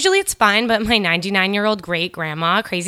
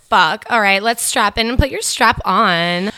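ordinary talk

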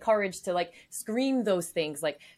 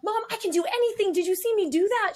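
put-on high, proud little girl voice; excited child; 11 semitones higher than usual. Mother imitates her daughter bragging in a high child voice. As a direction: beam like a kid after gymnastics. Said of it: "she was like".

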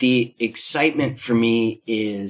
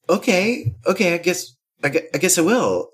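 hesitant agreement; 6 semitones higher than usual. Retold or imitated. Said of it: "she's like".

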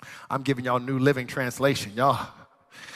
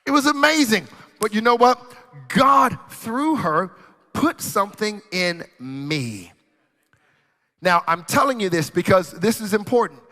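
high, loud preaching cadence; 6 semitones higher, 5 dB louder than usual. Acted. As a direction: preach it out, big and raised. Preaching at full force.

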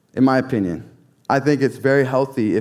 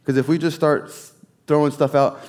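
ordinary talk

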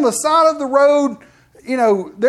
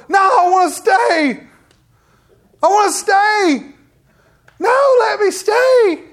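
put-on high, slow little-kid pleading; pleading child voice; whiny child pleading; 9 semitones higher, 5 dB louder, slower than usual. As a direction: drag it out like a begging child.

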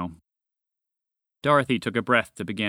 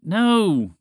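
loud, high, exasperated no; firm refusal; 7 semitones higher, 6 dB louder than usual. A narrator's reading. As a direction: shut it down loudly, pitch up. Roger scolds the roaring dragon.

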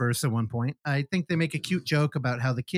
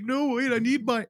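high-pitched, pleading imitation; mock pathetic pleading; 9 semitones higher than usual. Husband begs as wife hangs up, mock desperate pleading. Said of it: "fucking pathetic motherfuckers".

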